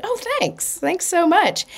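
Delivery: high bright mock-gushing thank you; overly sweet little thank you; chirpy thanks. Retold reply to compliment.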